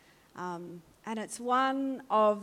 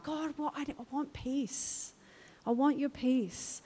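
much softer, higher, slowing plea; hushed prayer from the heart; quiet heartfelt plea; 4 semitones higher, 8 dB quieter than usual. Retold or imitated. Preacher voicing the cry of her heart, hushed plea. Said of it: "that's the cry of my heart".

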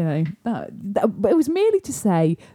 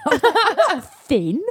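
laughing protest; 9 semitones higher, slower than usual. Real, not acted. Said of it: "indignant denial with laugh".

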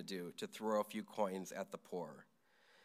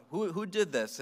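mock angry yell, raised pitch and volume; retold yell; 7 semitones higher, 5 dB louder than usual. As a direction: imitate an aggrieved man calling out. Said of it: "he yells out".

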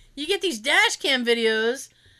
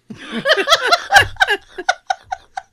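high laugh; 7 dB louder than usual. Real, not acted. Friend laughing at her own story, high laugh.

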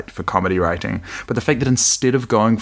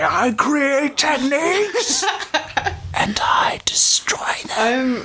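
whisper-yelled, slow and far higher; Eddie Redmayne impression; much slower than usual. Friend mocking a movie villain, slow higher whisper-yell. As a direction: swing between a strained yell and a breathy whisper, slowly. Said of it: "the Eddie Redmayne impression".

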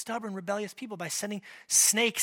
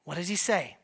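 ordinary delivery

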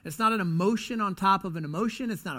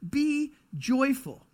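"be joyful" drawn out, pitched up; slow emphatic; 7 semitones higher, much slower than usual. Shown as a demonstration. Pastor restates the command for the church, slow and emphatic. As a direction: stretch "be joyful" slowly. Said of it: "In other words".

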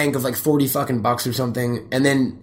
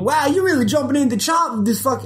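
mocking co-host; 12 semitones higher than usual. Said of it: "you were all fucking".

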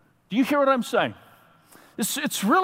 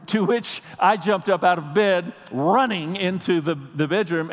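ordinary talk

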